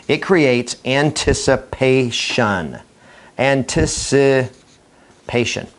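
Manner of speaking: very slow, "anticipation" drawn out syllable by syllable; suspenseful, broken-up "anticipation"; building anticipation. Shown as a demonstration. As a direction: stretch "anticipation", then repeat it with gaps inside. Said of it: "A pause creates something very powerful".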